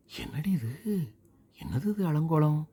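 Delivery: startled scolding of a soaked daughter; shocked mother. Acted. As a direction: Tamil mother gasping at the mess.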